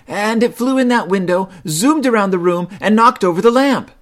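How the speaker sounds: high, loud little bear cub; eager cub. A narrator's reading. Brother Bear starts the whopper for Mama, eager. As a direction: pipe up like a fibbing child. Cubs voice.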